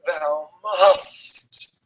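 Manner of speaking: a touch of a strange dark-lord voice; villain voice. Acted. Reading Voldemort insisting, light villain voice. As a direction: lay down the command, mildly villainous. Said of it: "he yelled".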